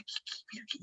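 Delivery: whispered, hurried disbelief; stunned whisper. Retold or imitated. Dani questions his announcement during the service, stunned whisper. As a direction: whisper it as if baffled. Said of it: "la Dani me dice".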